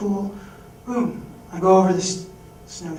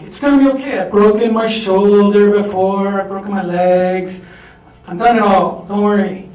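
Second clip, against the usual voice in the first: reassuring; 3 semitones higher, 9 dB louder than usual. Retold or imitated. Reassuring the crying staff.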